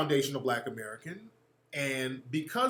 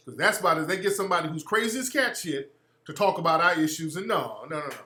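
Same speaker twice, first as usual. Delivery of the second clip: normal talking voice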